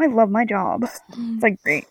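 soft, lightly put-on cheer; customer service voice. Worker covering up misery for customers, soft put-on contentment. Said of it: "your customer service voice".

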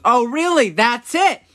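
high mock-outraged mom; mom flipping out. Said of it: "She starts flipping out".

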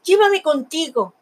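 high shouted call; Love shouts to Vanity's passing boat; shouting. A narrator's reading. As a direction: stretch up and shout to her. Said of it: "le gritó".

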